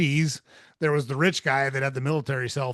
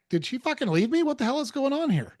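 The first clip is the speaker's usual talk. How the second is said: alarmed inner thought; 12 semitones higher than usual. Retold or imitated. Panicked inner thought on coming home.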